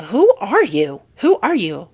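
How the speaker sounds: loud indignant challenge; indignant demand at a rude stranger. Real, not acted. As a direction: demand it loudly. Confronting trolls.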